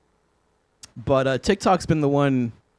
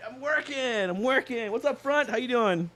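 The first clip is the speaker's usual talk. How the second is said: mock excuses; 9 semitones higher, 8 dB quieter than usual.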